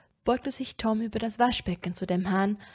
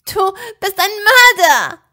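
loud, high, outraged shout; shouted accusation; 11 semitones higher, 15 dB louder than usual. A narrator's reading. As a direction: cry out the accusation at full force. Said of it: "rief ich". (laut und anklagend geschrien)

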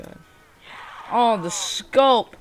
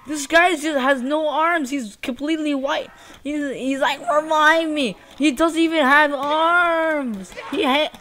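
higher, louder baffled complaint; incredulous exclaiming; 6 semitones higher, 4 dB louder than usual. Real, not acted. Gamer describing a glitched armless player, incredulous exclaiming. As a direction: protest in amazement, pitch up.

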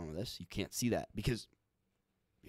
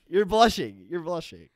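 higher, louder mocking tease; loud teasing; 7 semitones higher, 10 dB louder than usual. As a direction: crow it at her, louder. Teasing her about blushing.

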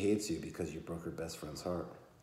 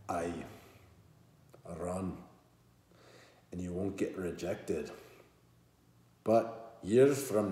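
bad Scottish accent; 10 dB louder than usual. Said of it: "his voice takes on a Scottish accent".

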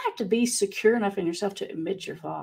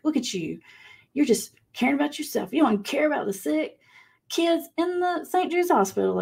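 higher mock scolding that climbs as it goes; shaming; 7 semitones higher than usual. Mom shaming her son for not donating, in a rising, higher-pitched scolding voice. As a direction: pile on the guilt, half-mocking herself. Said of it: "the guilting, the shaming thing".

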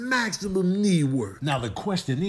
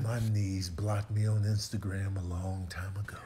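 whisper; 8 semitones lower, 6 dB quieter than usual. Acted. Joke aside about knees.

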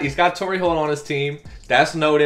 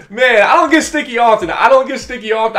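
higher, louder hyped talk; excited; 8 semitones higher, 7 dB louder than usual. Real, not acted. Gamer still hyped, telling viewers about the win. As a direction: still buzzing after the score. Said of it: "I gotta get excited".

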